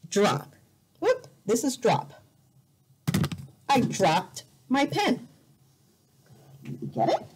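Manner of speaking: ordinary talk, nothing marked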